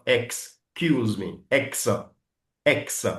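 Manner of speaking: very slow, broken into ex and kiouz-me; sound-by-sound model. Shown as a demonstration. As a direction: spell out the sounds slowly. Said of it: "Après ça, vous avez le ou". (décomposé très lentement)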